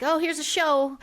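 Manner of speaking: mock a mom putting on a show; fake mom. Mom mocking a fake show for the kids, put-on voice. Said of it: "a fake mom being like".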